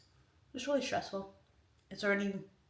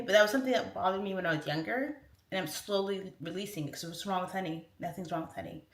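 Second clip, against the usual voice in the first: usual speaking voice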